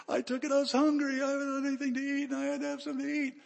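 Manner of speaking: put-on high, rushed child's voice; little boy confessing. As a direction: blurt out a little kid's confession. Poor little Willie confesses hunger.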